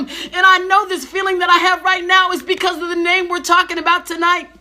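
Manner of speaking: rising, fervent preaching voice. Real, not acted. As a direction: preach it with building fervour. Preaching at full force.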